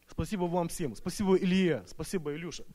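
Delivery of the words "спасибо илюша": ordinary talk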